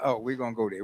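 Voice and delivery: ordinary talk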